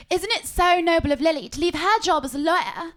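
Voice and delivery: lightly mocking posh voice; posh friend impression. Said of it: "Tilly was like".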